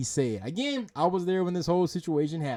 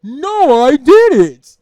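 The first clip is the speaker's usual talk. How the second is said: much louder, higher hammed-up cartoon reply; goofy mocking voice; 6 semitones higher, 15 dB louder, much slower than usual. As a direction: ham it up like an over-animated streamer. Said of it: "Stop with this animated bullshit".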